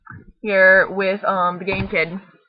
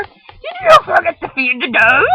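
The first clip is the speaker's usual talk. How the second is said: put-on high, loud mother calling her kid; mom voice calling; 8 semitones higher, 8 dB louder than usual. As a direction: call it across the kitchen like mom. Mother scolds Helen.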